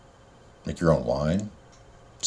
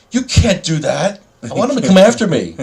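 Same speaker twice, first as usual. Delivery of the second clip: mock challenge; 11 semitones higher, 3 dB louder than usual. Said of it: "He's gonna be like".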